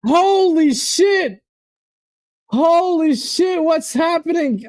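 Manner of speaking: high, slow holy shit in disbelief; stunned. Real, not acted. Fan stunned by a second Arsenal goal. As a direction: let the disbelief drag out.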